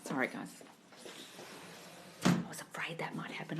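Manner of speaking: whispered worry after being told off; whispering. Real, not acted. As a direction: whisper so the neighbours won't hear. After landlord complaint, hushed.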